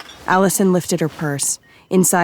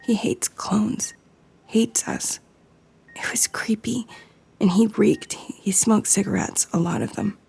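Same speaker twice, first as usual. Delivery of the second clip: whispering through tears; hushed, upset, close to crying; tearful whisper; 5 semitones higher, 4 dB quieter than usual. Acted. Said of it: "tears were running down her face".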